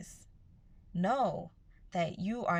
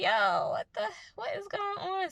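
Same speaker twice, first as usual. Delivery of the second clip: mock bewilderment; 5 dB louder than usual. Acted confusion under heavy layers.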